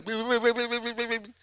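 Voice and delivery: mock quivering-chin blubber; temblor de miedo. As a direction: imitate a scared child, soft and trembling. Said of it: "me temblaba la pera". (imitando un llanto tembloroso de niño)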